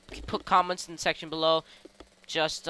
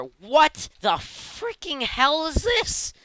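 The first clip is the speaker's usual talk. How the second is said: slow, climbing, high-pitched amazement; exaggerated disbelief; 10 semitones higher, much slower than usual. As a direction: stretch it out to a squeaky rising "this". Repeats the amazement, bigger.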